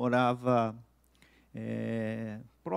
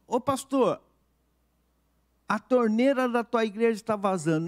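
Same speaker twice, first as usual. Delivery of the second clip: lightly imitated call, pitched high; higher, projected retold call; porter hailing pastor; 8 semitones higher, 4 dB louder than usual. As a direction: hail the pastor as the porter did.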